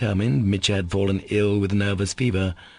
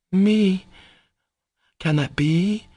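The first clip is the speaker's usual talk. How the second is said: high, startled question, louder on the first word; faltering shock; 11 semitones higher, 3 dB louder, much slower than usual. A narrator's reading. Katya, startled by the summons, questions Alyosha falteringly. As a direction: falter in high-pitched disbelief. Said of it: "she faltered".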